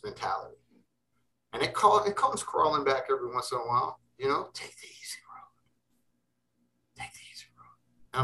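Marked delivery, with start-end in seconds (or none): 4.5-8.2 slow, very soft tempting whisper. Acted. Voice in his head tempting him to give in, whispered. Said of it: "you just hear that whisper".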